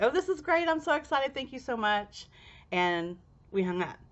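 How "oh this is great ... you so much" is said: high, giddy retold gush; bubbling over with thanks; excited gush. To her friend after the ritual, giddy thanks. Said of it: "and I was just like".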